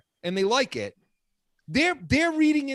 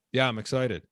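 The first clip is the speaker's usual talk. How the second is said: flat monotone; 10 semitones lower, 4 dB quieter than usual. Retold or imitated.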